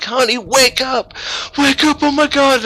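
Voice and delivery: high, loud, excited yell. Retold or imitated. Husband waking his sleeping wife at three, excited high yell. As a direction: shake her awake, overjoyed and loud. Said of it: "I'm yelling".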